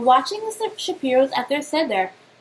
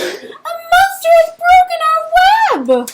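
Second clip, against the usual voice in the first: put-on high, loud panicked child voice; scared child spider crying to mother; frightened cry; 9 dB louder than usual. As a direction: cry out that a monster broke the web. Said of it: "he screamed".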